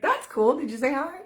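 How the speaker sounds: bright, amused reply. Retold or imitated. Mother answering her son about the hawk, delighted. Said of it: "So I'm like".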